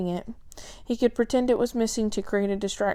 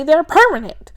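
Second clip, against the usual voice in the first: loud, high, hitting "permanent"; emphatic; 8 semitones higher, 11 dB louder than usual. Acted. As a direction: bang down on "permanent". Billy stresses the problem.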